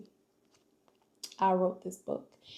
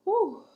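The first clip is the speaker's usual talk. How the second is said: shaky exhale; 12 semitones higher, 6 dB louder than usual. Real, not acted. Collecting herself after near tears.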